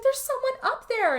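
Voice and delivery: high, frantic plea; panicked teen girl. Retold or imitated. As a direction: squeal it like a scared kid. Hannah voiced panicking to her parents.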